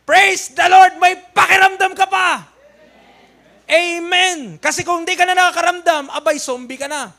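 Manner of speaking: loud, high preacher's shout; fiery preaching. Acted. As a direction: preach it hard to the congregation. Preaching at full force. (malakas at mataas na pangangaral)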